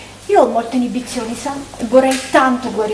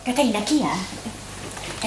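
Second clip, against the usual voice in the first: hushed question; 2 semitones lower, 10 dB quieter, slower than usual. Acted. Asks quietly while on phone.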